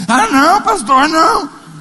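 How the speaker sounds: high, drawn-out whiny plea; mock pleading gossip. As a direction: whine like a caught gossip backing off. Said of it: "vou te levar lá no pastor". (fofoqueiro pego, choramingando alto e arrastado)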